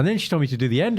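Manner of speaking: usual speaking voice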